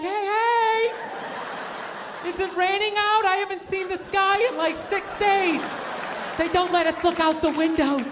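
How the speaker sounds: high, stretched-out needy call; hammed-up lonely office worker pleading for a chat; starved for contact. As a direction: call out desperately to a passerby. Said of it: "starved for some sort of human contact".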